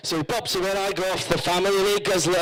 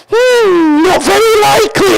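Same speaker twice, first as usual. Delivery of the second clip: very high, loud, drawn-out squeal; high-pitched mock answer; 14 semitones higher, 13 dB louder, slower than usual. Comic answering a weight-loss survey, high loud squeal. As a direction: squeal it out far above normal pitch.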